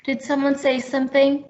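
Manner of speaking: ordinary talk, nothing marked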